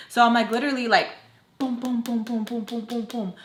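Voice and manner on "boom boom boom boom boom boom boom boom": rapid run of booms; imitated pounding. Narrator banging on the closed door, imitated pounding. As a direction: mimic fists banging with the voice.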